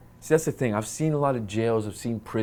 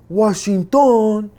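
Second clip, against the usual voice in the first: Washington loud and much higher, a sudden exclaim; excited recognition; 10 semitones higher, 8 dB louder, much slower than usual. Retold or imitated. Guard suddenly realizes the prisoner is American.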